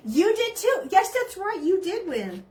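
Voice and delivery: high, loud eager reply; excited. Real, not acted. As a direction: respond with bright enthusiasm. Replying to viewer comment, excited.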